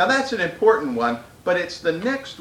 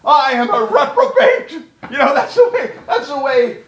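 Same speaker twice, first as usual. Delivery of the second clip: laughing through the words, higher; 8 semitones higher, 6 dB louder than usual. Real, not acted. Preacher laughing at his own impression, higher. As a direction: chuckle as you say it. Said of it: "laugh heard on the lines".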